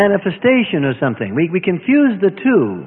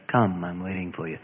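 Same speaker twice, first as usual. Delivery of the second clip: soft, much lower voice of God calling; gentle quiet call; 13 semitones lower, 12 dB quieter than usual. Retold or imitated. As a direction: call softly, patiently waiting. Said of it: "He says".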